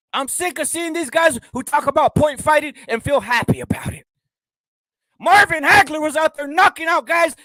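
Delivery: high, loud outraged yelling; angry rant. Real, not acted. Vlogger ranting against point fighters, loud and high. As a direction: shout your disgust.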